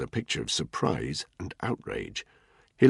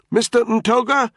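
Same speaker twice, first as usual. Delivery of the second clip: yelling over the deafening rotor roar, much higher and louder; shouting; 8 dB louder than usual. A narrator's reading. Danny shouting to Ntoga over the chopper noise, full shout. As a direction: shout the name across the airfield. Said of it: "Danny shouted at the three men".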